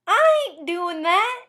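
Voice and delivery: high, slow Southern twang; sassy Southern refusal, drawn out; Southern accent. Shown as a demonstration. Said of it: "then becomes".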